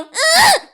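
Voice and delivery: a high, loud, exaggerated "ugh" of disgust; mock disgusted groan. Showing how cruel it is to jeer at a crying kid, loud mock groan. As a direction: sneer at a crying kid with one big ugh. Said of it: "You don't look at a kid that's crying and go".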